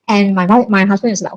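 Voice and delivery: usual speaking voice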